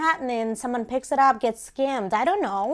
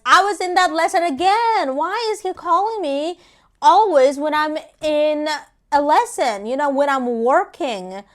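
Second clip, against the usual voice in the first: loud, high-pitched exasperated complaint; frustrated, emphatic venting; 5 semitones higher, 7 dB louder than usual. Retold or imitated. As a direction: raise your voice in mild exasperation at the bad timing. Exasperated about calls during lessons.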